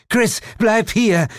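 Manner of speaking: high, loud, slow plea; desperate call. Acted. Jane calls the toad Chris back, high slow desperate plea. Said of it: "rief die Frauenstimme verzweifelt".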